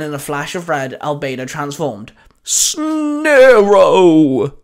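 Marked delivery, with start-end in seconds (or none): 2.4-4.5 drawn-out, high, loud battle cry; called out. A narrator's reading. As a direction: shout the name long and high, falling away. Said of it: "the mummy alien called out".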